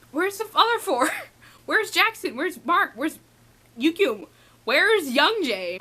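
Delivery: high-pitched run of urgent questions; frantic searching. Retold or imitated. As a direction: relive the frantic hunt for each one. Retelling frantic search for the others.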